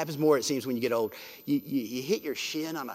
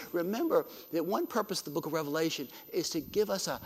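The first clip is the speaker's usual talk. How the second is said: ordinary talk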